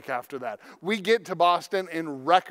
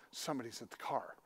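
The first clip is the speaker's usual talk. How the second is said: much softer, quick hushed warning; hushed alarm; 5 semitones lower, 14 dB quieter, faster than usual. Retold or imitated. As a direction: warn him without waking the kids. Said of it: "And she goes".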